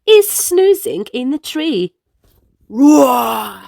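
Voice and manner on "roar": put-on a playful growling roar for children; tiger roar. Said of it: "He wakes her".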